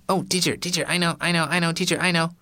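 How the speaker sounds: high, eager hand-up voice; eager pupil Patty. Acted. Patty begging the teacher to pick her, high and eager. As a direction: beg to be picked. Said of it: "Patty put her hand up".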